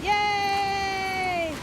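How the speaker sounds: cheering. Real, not acted. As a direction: cheer the dog on. Cheering the dog.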